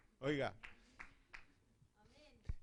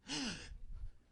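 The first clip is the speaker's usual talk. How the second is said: shocked gasp; 8 semitones higher than usual. Retold or imitated. Shocked at being told to give house.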